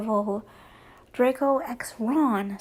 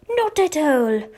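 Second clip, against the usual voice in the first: louder retort in a put-on British accent; accidental put-on accent; 3 semitones higher, 6 dB louder than usual. A narrator's reading. Harry answering Draco's taunt, hammed-up British accent. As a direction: slip into an exaggerated British accent. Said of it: "No idea where that accent came from".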